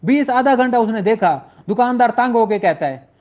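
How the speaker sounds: ordinary talk, nothing marked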